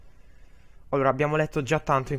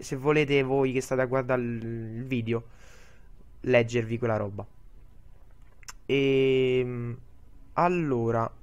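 normal talking voice

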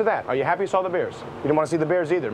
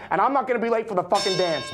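raised higher and insistent; heated vow; 7 semitones higher than usual. Acted. Man vowing he won't be late for the dance, heated. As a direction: push it out hot. Repeated line, heated then quiet.

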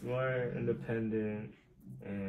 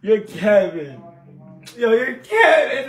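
much higher and louder, slowing on the last call; excited shout; 12 semitones higher, 17 dB louder, slower than usual. Real, not acted. Friend on stream shouts the guessed name, excited. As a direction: yell the name like you caught him out.

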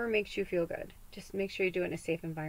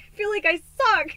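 high, loud self-pitying wail; despairing wail; 12 semitones higher, 9 dB louder than usual. Retold or imitated. Venting to Spirit, loud and self-pitying. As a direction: wail it out half-laughing at yourself. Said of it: "I'm like".

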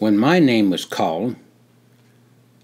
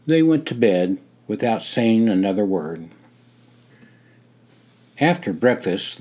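usual speaking voice

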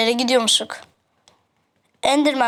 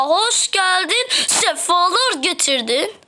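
mock welcome; 6 semitones higher, slower than usual. Mock greeting to enemy.

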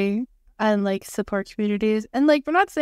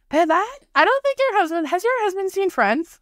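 mock yell; 9 semitones higher than usual. Friend moving furniture with someone's husband, mock yell. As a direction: call it out high, mock yelling. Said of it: "I have to yell".

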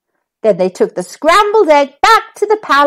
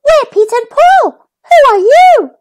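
high chirpy bird voice, louder; tweeting bird; 12 semitones higher, 4 dB louder than usual. A narrator's reading. Little birds tweeting hello to Humpty. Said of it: "they tweeted".

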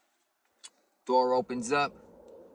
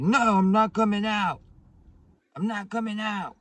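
very high, loud mock wail; high put-on woman's voice, yelling stubbornly; imitated shouted refusal; 11 semitones higher, 9 dB louder than usual. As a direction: holler a refusal through a bathroom door. Refusal shouted from bathroom, acted.

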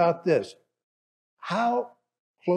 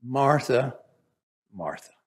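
gentle reply; 4 semitones lower than usual. Acted. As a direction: say her name kindly. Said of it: "here's Jesus' response".

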